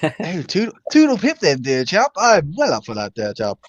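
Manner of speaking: hammed-up English toff accent with swooping pitch; heavy English accent. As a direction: lay the English accent on thick, jokingly. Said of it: "rely on it as heavy as possible".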